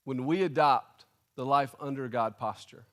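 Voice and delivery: normal talking voice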